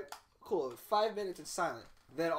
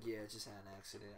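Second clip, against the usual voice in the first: embarrassed mumble; 10 semitones lower, 17 dB quieter, faster than usual. Retold or imitated. Embarrassed kid answers after sneeze.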